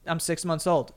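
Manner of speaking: normal talking voice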